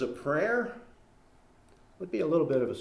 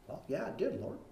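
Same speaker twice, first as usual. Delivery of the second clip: much softer, caught-out reluctant yes; sheepish admission; 11 dB quieter than usual. Pretend. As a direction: mumble the concession like a scolded child. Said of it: "answering God at judgment".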